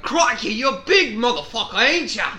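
put-on Australian accent, laughing; mock Australian. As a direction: play an Aussie wildlife presenter. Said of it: "I was like".

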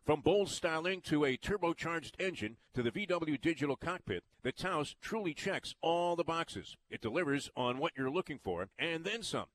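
upbeat radio ad read. Acted. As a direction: sell it like a car dealer spot. Reads a car ad.

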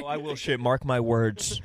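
loud, laughing oath; hammed-up loud promise; mock solemn shout. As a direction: swear it loud like a vow, half joking.

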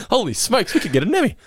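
high, excited cartoon exclamation; Jimbo's excited exclamation. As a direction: gasp it out like a delighted old vet. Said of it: "I love the term holy smokes".

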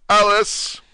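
high, loud shrill call. Acted. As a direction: shout the name at the top of your voice. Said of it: "at the top of his shrill little voice".

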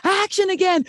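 calling action. Retold or imitated. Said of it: "and I'm like".

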